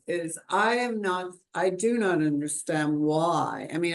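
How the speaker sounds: normal talking voice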